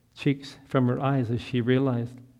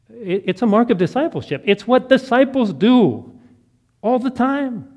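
raised high and loud, pressing the point; preacherly exhortation; 9 semitones higher, 5 dB louder than usual. Retold or imitated. Pastor urging Anna to keep praying, fervent and loud.

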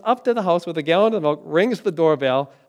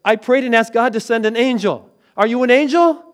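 raised, higher voice, building to a loud question; retold yell; 6 semitones higher, 8 dB louder than usual. Wife calls out to the stranger with the milk, retold yell. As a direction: imitate a wife calling out in amazement. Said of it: "She yells out and she said".

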